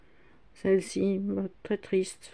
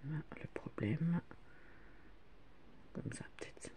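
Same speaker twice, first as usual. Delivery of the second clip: whispering; 19 dB quieter than usual. Real, not acted. Fiddling with camera, whispered aside.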